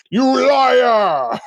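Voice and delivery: high, loud acted shout; mock furious accusation. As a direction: roar the accusation as the spirit father. Spirit father accusing his son.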